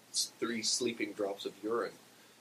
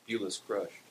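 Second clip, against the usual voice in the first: plain reading voice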